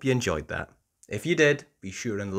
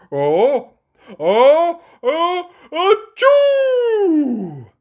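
rising oh oh oh into a loud achoo; building sneeze; 12 dB louder, much slower than usual. Acted. Daddy Pig sneezing, rising into a loud achoo. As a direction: wind up and sneeze big. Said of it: "makes Daddy sneeze".

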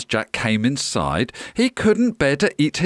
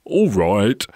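lower-pitched giant's assent; grinning giant; 5 semitones lower than usual. A narrator's reading. Giant lets the pirates in, grinning giant voice. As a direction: agree like a big grinning giant. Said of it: "grinned the giant".

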